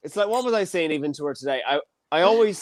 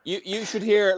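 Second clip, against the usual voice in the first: ordinary talk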